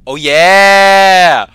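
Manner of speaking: high, loud, triumphant yell; hyped yell. Pretend. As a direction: shout it in triumph. Celebratory yells.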